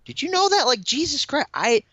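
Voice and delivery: very high, incredulous outburst; astonished. Real, not acted. Podcaster reacting to surprising cast trivia, astonished. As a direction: blurt it out in high-pitched disbelief.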